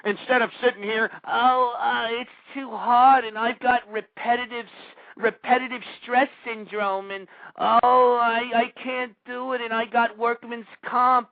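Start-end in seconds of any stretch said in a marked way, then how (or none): 1.3-11.3 slow, mocking whine of a lazy worker; mock whiny worker. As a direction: ham up a lazy worker's whining.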